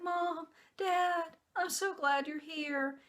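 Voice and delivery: put-on a relieved little boy glad to see his parents; voicing her son. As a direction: son greeting both parents after surgery, lightly voiced child. Voicing her son after surgery.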